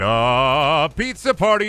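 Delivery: a manager springing a pizza party; mock pizza party cheer. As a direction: sell it like a fake-cheerful manager. Boss announces again.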